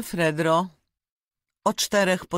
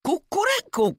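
second crow much higher; rooster crow; 9 semitones higher than usual. Acted. Roosters crowing at each other in the yard, rising crow. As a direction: crow like two fighting roosters. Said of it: "krzyknął kogut".

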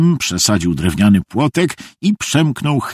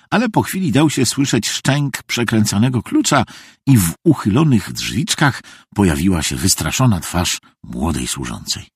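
usual speaking voice

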